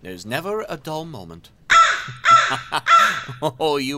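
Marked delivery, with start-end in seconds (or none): 1.7-3.4 high, loud, gleeful villain cackle; evil cackle. Acted. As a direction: cackle wildly in triumph. Villain gloats, never dull moment.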